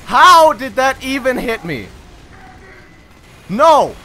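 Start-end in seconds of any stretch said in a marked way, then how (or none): 3.5-4.0 loud shouts. Real, not acted. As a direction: yell through the fight then cheer. Tense fight, then win.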